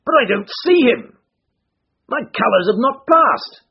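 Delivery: high, loud anxious cry; agitated. A narrator's reading. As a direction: fret out loud. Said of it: "cried the Colonel in great agitation".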